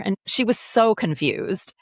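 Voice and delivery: ordinary delivery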